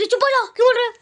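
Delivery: mock scolding of the kids; telling off. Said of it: "हम लोग".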